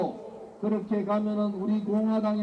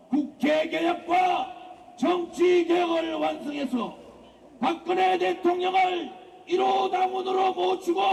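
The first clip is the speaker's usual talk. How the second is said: roaring rallying speech; 6 semitones higher than usual. Real, not acted. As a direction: bellow it to a crowd of comrades. (목청 높인 집회 연설)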